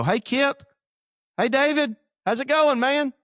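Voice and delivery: high, chummy buddy hello; overly casual greeting. Retold or imitated. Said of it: "at a restaurant and go".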